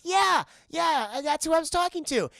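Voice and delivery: put-on high, eager kid voice; little girl voice. Streamer voicing the girl reassuring her mom, high and eager. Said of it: "She goes".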